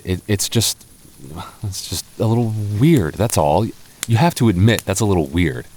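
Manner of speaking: usual speaking voice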